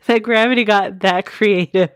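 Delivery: normal talking voice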